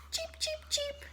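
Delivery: tiny high soft cheeping; baby chick cheeps. Acted. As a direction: peep like newborn chicks.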